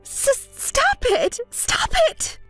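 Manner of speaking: high, stammering, drawn-out plea; very high, upset cry; distraught protest. Acted. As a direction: stammer and squeal in panic. Panics as friend cuts ties.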